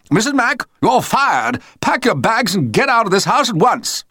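loud, high furious bellow. A narrator's reading. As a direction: roar it down the hall. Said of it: "heard him bellow".

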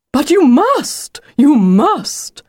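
high, stretched-out pleading on "must"; insistent exclaiming. A narrator's reading. Wolf urging Lucy to recognize him, exclaiming. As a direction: insist urgently, swooping high and slow. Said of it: "exclaimed the wolf".

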